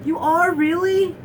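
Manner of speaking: high, loud mock astonishment; over-the-top disbelief. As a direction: imitate someone making a huge deal of it. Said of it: "so over the top".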